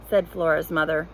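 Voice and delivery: usual speaking voice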